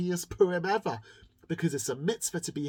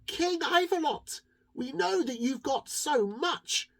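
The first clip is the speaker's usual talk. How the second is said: higher, slow polite plea; pleading townspeople; 10 semitones higher than usual. Acted. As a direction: address the king sweetly and slowly. People petition the king.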